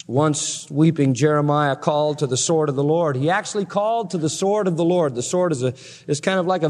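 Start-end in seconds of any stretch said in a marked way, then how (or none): none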